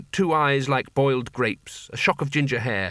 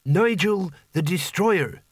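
slow, higher, puffed-up title; proud boy hero; 5 semitones higher, slower than usual. Acted. Boy proudly corrects Rincewind with his full title, slow and grand. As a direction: announce your hero name slowly.

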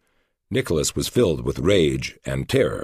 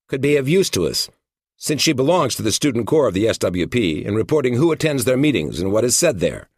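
other FBI agent; 7 semitones higher, 3 dB louder than usual. A narrator's reading. Narrator voices second agent.

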